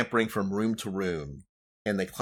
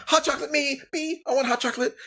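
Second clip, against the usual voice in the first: put-on high, fast eager child voices; clamor like greedy little kids; children begging for hot chocolate, high and quick; excited kids; much faster than usual. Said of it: "kids jump down".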